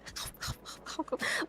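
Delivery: soft, high, airless giggle; breathy laugh. Real, not acted. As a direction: giggle almost silently.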